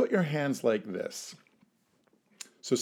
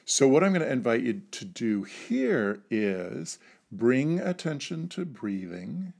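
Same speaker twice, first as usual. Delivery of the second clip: ordinary talk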